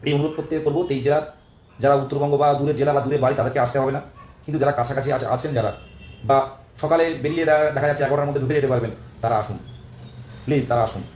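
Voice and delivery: ordinary talk, nothing marked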